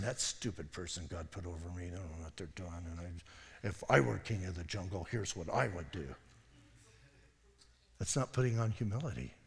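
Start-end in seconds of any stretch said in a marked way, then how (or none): 0.0-5.3 mock grumbling. Proud person grumbling about boss.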